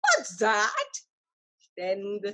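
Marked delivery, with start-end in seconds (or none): 0.0-0.9 high, bright what's that; eager question. A narrator's reading. Boy eagerly asks the tree, high. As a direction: jump at the idea.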